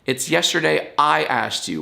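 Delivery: ordinary talk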